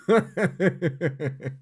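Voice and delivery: amused burst of laughter. Real, not acted. Admitting poor geography to viewers, laughing.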